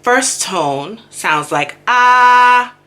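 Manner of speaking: ah held long and high on the first tone; slow, sung-out tone demonstration; tone demo. Shown as a demonstration. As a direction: stretch out ah high and level. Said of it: "in my head, I have to say".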